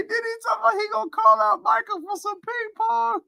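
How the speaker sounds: high squeal of suppressed laughter; helpless laughter. Real, not acted. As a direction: squeeze the words out while cracking up. Cracking up at ping pong story.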